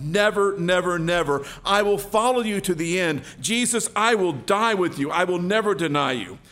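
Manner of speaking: acted passionate insistence; arguing vehemently. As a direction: argue back with a fervent vow. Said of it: "And Peter argued".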